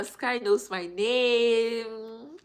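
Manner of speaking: loud, very slow drawn-out line; delighted. Real, not acted. Vlogger delighted that a viewer knows her name. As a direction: stretch it out in delight.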